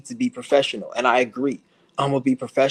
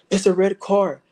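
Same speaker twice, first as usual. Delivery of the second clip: higher, jittery paranoid voice; mocking a paranoid targeted individual, pitched up; paranoid street guy; 6 semitones higher, 3 dB louder than usual. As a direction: mimic a frantic man pointing out cars.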